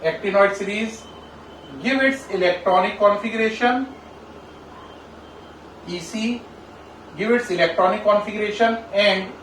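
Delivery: very slow, spaced-out dictation; slow dictation with long pauses; dictating a question. Real, not acted. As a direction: read it out piece by piece for note-taking. Reads out exam question for copying.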